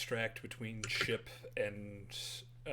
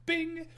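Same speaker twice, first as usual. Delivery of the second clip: mouth-made bright ding sound; ding like an elevator arriving; high elevator ding; 8 dB louder than usual. Acted.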